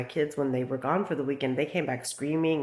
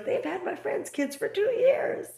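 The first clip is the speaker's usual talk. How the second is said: very high, slow mimicked voice; imitated alarmed warning; slower than usual. Retold alarmed warning from Blake.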